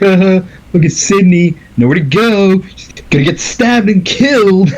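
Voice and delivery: high, goofy villain taunt with a laugh; teasing singled-out victim, high-pitched; mock gloating. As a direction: gloat like a giddy movie killer. Acting two gloating killers.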